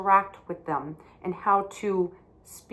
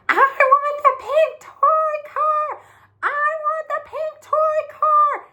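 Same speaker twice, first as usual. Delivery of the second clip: put-on high, loud, slow whiny child's scream; screaming tantrum; 9 dB louder, slower than usual. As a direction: shriek it like a little girl throwing a fit. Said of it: "she was screaming".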